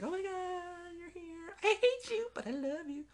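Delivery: high teary overacted wail; mock crying greeting. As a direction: whimper it like a melodramatic reunion. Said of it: "He's like".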